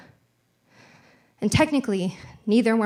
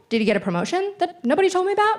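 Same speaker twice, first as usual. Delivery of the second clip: incredulous; 9 semitones higher, much faster than usual. Retold or imitated. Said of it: "I was like".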